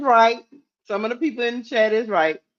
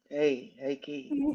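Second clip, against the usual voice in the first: low, hushed bedroom-voice imitation; very soft, low, teasing coach impression; mock pillow talk; 5 semitones lower, 11 dB quieter, slower than usual. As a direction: softly croon it as a mocking impression. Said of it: "What if I come up here and be like".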